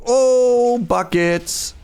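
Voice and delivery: high, loud drawn-out "oh"; startled exclamation. Real, not acted. Gamer caught off guard underground, startled high exclamation. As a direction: blurt it out as the voice jumps up.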